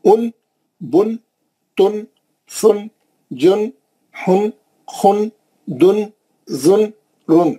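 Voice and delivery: teacher reciting double-pesh row; slow dammatan drill. Shown as a demonstration. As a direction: chant ان، بن، تن with the n held. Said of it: "پڑھ کے نون ساکن پڑھیں گے".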